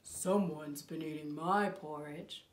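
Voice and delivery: slow, low bear voice; great big bear. Acted. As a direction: rumble it low like a huge bear. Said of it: "What does the great big bear say?".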